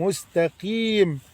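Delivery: مستقيم slowly, quietly, full kasra on the قي. Shown as a demonstration. Teacher drilling the full kasra, softly. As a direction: draw out مستقيم, soft and clear kasra. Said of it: "كسر تام".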